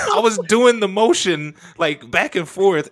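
high, loud laughing through words. Real, not acted. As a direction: laugh while you tell it. Laughing while retelling.

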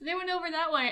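breezily helpful, as if nothing is amiss; nonchalant demons. Retold or imitated. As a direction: imitate an unbothered, easygoing devil. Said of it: "totally nonchalant demons".

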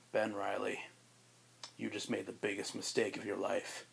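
low, quiet, sinister threat; dark menacing Kane. Acted. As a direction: murmur it like a dark, unhinged stalker. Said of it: "in the darkest".

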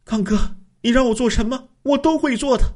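high, yielding woman's voice; the wife promises her husband to do anything, high and yielding; submissive wife. A narrator's reading. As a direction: give in to him in a high, pleading voice.

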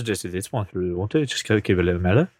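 low, fast, lightly Welsh-sounding voice; mellow Welsh accent. Shown as a demonstration. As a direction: slip into a loose, mellow Welsh lilt. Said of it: "that's more Welsh".

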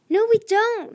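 high, loud protest; alarmed cry. A narrator's reading. Starflight refusing to enter the hole, alarmed cry. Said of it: "Starflight cried".

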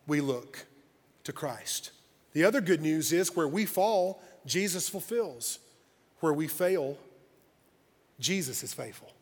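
low, soft preaching with pauses; quiet, solemn pulpit delivery; hushed sermon close. Real, not acted. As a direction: settle quietly on each phrase.

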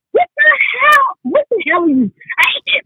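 what-the-hell exasperation; high, shocked exclamation; outraged disbelief. Real, not acted. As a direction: pitch shoots way up in disbelief. Outraged reaction to his talk.

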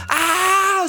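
high, loud, hammed-up scream of pain; imitating his own panicked scream; retold scream. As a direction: belt out a mock yell of horror. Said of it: "y empiezo a gritar".

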